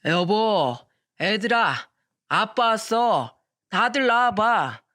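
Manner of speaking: put-on drunken husband calling out, slow and slurred; drunk loud calling. Drunk husband calling family at dawn, slow loud call. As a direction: drag the words out like a drunk waking the house. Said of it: "큰 소리를 치면서 말하더군요".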